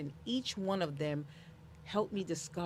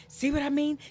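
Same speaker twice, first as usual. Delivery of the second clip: higher, louder mock of a classmate; 8 semitones higher, 8 dB louder than usual. Schoolboy pointing her out to his friend, mildly mocking. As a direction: lightly mimic a kid's gotcha, raised voice. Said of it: "one of them says".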